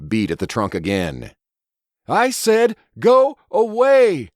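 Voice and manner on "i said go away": high, loud, slow shout; loud order. Acted. Judith bellowing at the beast outside the trunk, loud and slow. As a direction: bellow it like ordering a naughty child. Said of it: "raised her thirst-ragged voice as loud".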